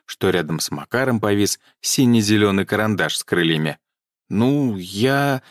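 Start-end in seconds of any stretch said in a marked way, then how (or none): none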